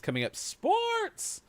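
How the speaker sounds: slow, high hype call; hyped announcer. Acted. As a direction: stretch it out like a host. Show host announces next segment.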